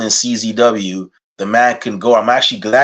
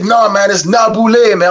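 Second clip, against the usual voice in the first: higher voice of a friend setting him straight; correcting friend; 8 semitones higher, 3 dB louder than usual. Retold or imitated. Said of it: "He's like".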